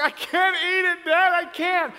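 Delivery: high, loud, drawn-out wail; hammed-up child's protest; whining son. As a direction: wail it like an overfull kid. Son refusing the food.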